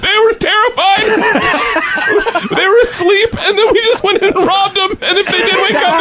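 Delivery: high, laugh-cracked voice; laughing hard. Real, not acted. Admitting they robbed sleeping classmates, laughing high. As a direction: laugh through every word.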